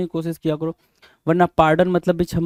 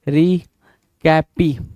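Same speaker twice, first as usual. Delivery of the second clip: "Recapitulate" very slow, broken by long pauses; syllable by syllable; 3 dB louder, much slower than usual. Shown as a demonstration. Teacher showing learners how the word sounds, drawn out in pieces. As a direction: stretch out "recapitulate" in separate chunks: ri, cappi, chulate.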